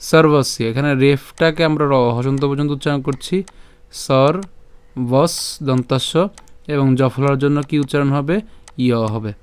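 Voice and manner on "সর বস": "সর বস" broken into sor, pause, bos; "সর বস" separated, stopping on each final consonant; pronunciation demo. Shown as a demonstration. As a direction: say "সর বস" syllable by syllable. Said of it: "উচ্চারণটা just আমি যদি আরেকবার একটু দেখাই".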